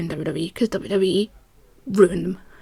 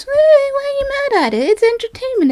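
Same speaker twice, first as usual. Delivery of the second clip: much higher, breezy shrugging voice; carefree voice; 13 semitones higher than usual. Retold or imitated. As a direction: play the calm dismissive fan. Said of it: "I'm the kind of person that goes".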